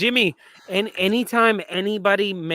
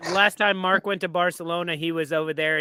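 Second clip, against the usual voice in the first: ordinary talk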